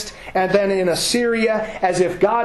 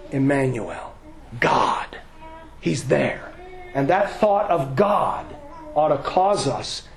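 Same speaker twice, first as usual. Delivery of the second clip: hushed exhortation; 5 semitones lower, 4 dB quieter than usual. Acted. Preacher gently urging the congregation to calm, low and soft. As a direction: draw them in close, quietly.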